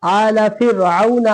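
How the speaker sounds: फ़िरऔना with a light r, chanted slowly; thin ra. Shown as a demonstration. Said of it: "यहाँ पे हम लोग पतला पढ़े".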